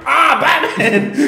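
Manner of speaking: high, slow panicked wail; panicked cry. Retold or imitated. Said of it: "He's freaking out".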